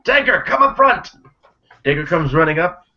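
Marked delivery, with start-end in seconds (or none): 0.0-1.1 high, loud call to the back room; shout. Acted. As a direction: call out across the shop. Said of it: "he shouts into the back".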